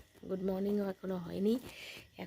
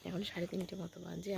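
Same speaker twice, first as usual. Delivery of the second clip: normal talking voice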